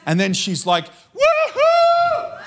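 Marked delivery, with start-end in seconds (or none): 1.1-2.4 whooping yell. Retold or imitated. Woman in a miniskirt whooping during church worship, ecstatic high yell. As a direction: let out a wild, squealing woohoo. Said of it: "She's jumping and she's yelling".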